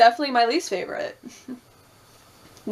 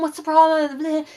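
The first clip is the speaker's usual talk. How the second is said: annoyed; 5 semitones higher than usual. Retold or imitated. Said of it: "Sayora was annoyed".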